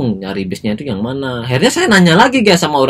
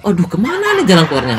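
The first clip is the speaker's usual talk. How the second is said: higher, bewildered self-quote; bingung; 6 semitones higher than usual. Retold or imitated. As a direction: wail it lightly, lost and confused. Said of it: "Akhirnya saya bingung". (bingung, setengah mengeluh dengan nada tinggi)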